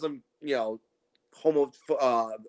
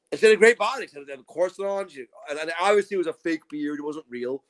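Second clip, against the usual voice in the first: usual speaking voice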